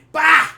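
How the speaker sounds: sudden loud high burst "Bah!"; explosive mock bang shout; door-kick yell. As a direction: blast it out like a door bursting open. Dad kicks the door in.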